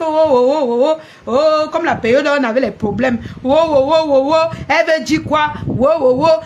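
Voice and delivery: loud mock oh oh oh chorus. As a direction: mimic their fake alarm. Mocks others' exclamations. (moquerie bruyante, oh oh oh)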